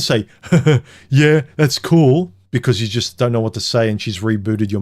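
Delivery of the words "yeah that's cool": slow, higher, louder forced agreement; fake agreeable laugh. Stunned date pretends to go along, falsely amused. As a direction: laugh it off with put-on approval.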